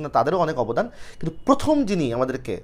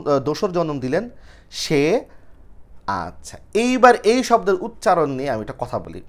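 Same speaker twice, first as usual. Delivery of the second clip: normal talking voice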